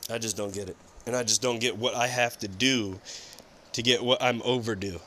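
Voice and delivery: low and quiet, defeated; quiet despair. Real, not acted. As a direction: sink into quiet hopelessness.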